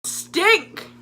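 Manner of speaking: high, loud blurted stink; mock accusing shout. Bob accuses the narrator of smelling, mock shout. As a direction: blurt it out at her. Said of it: "he comes up to me and he goes".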